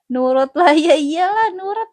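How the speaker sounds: loud playful insistence; excited. Real, not acted. (seru dan menggoda penonton)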